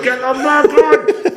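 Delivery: very high, slow, squeaky yelp; hammed-up panicked squeal; panicked director. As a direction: squeal it in alarm. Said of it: "Kenny in my left ear's gone".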